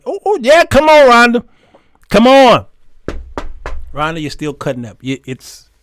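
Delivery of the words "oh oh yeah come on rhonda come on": loud, high delighted shouts; excited calling out. Real, not acted. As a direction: hype up the commenter. Reacting to online comments.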